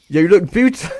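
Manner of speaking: high, very loud mock-British compliment; badly faked British, cracking up; butchered British accent. Bad British accent, breaking off.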